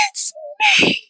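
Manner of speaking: very high excited cry; ecstatic shout. Retold or imitated. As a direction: shout it jumping for joy. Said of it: "I jump up and down".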